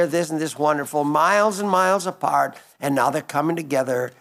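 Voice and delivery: usual speaking voice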